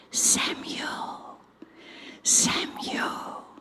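hushed, voiceless call of a name; whisper. Shown as a demonstration. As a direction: whisper the name as if calling a sleeper. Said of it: "do it as a whisper this time".

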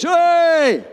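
loud high call across the field; shepherd's shout. Retold or imitated. Shepherd calling his sheep from down the road, loud shout. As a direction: call the flock with one big Hey. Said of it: "he'd shout with a loud voice".

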